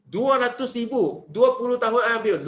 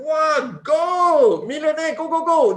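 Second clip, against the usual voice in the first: much higher, louder rallying shout; excited battle cry; 8 semitones higher, 6 dB louder, slower than usual. Acted. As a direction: urge the army forward, full throat. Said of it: "itu panglima cakap". (jeritan semangat yang kuat)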